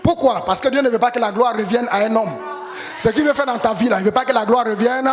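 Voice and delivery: loud, rising preacher's call to the congregation; full-force preaching. Acted. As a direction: preach it out, pushing each phrase harder.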